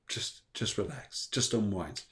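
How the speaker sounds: calm a tired carer down gently; soothing hush. Retold or imitated. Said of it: "everybody just went".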